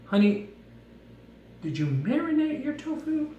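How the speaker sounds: lightly voiced mom, pitched well up; mom's voice. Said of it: "she said".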